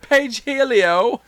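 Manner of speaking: high slow laughing cartoon voice; Cornholio-style pun.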